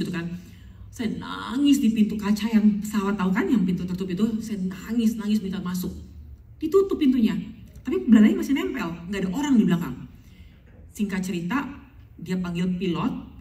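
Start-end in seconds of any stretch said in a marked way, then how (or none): none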